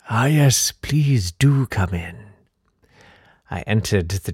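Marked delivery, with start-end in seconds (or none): 0.1-2.3 slow, drawn-out welcome; creepy old Count. Acted. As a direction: usher the guest in spookily. The Count welcomes his visitor.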